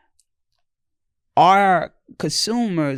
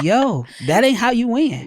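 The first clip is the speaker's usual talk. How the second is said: mocking; 7 semitones higher than usual. Mocking a bad sales mindset.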